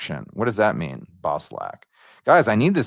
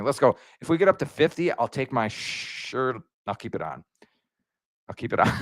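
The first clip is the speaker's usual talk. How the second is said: usual speaking voice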